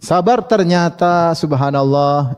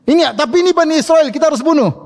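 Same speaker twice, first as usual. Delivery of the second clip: much higher, insistent tyrant's order; stern Pharaoh; 12 semitones higher than usual. Retold or imitated. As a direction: demand the killing, raised pitch. Pharaoh insists on killing baby. (Firaun bersikeras, nada tinggi memerintah)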